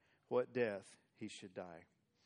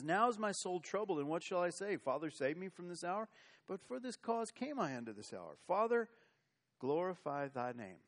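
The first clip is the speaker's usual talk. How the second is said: mild prayerful cry; 6 semitones higher, 5 dB louder than usual. Acted. Preacher reads Jesus' prayer aloud.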